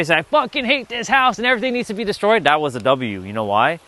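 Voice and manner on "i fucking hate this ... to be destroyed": high, fast mock rant; hammed-up tantrum without extra volume; retold frustration. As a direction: imitate a frustrated outburst, pitched up and rushed. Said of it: "when I was getting frustrated".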